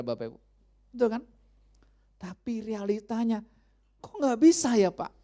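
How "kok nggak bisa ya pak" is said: ordinary talk, nothing marked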